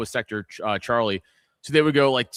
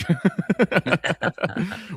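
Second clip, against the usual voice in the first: high laugh; 7 semitones higher than usual. Real, not acted. Laughs at own joke.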